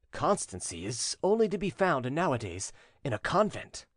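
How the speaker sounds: plain reading voice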